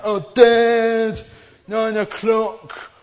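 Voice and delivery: put-on outraged teen protesting the curfew, dragging nine o'clock; whiny teenager. Teenager protesting the nine o'clock curfew to dad, high whine. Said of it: "that's my teenager impression".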